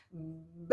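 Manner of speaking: B said as a soft lip pop, no vowel after it. Shown as a demonstration. Teacher showing children the B sound as a little lip pop. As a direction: pop the lips for B like a tiny burst. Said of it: "As duas juntas agora, ó".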